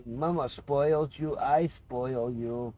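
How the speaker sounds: dote on the pet, hushed. Real, not acted. Owner doting on his spoiled cat, soft baby talk.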